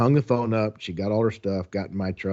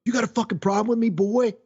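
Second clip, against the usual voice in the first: much higher, fast, menacing mock threat; aggressive guy threatening; 11 semitones higher, 3 dB louder, faster than usual. Guy outside the bar challenges the narrator, aggressive and high. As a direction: bark it like a bar tough picking a fight. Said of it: "the guy comes out and he goes".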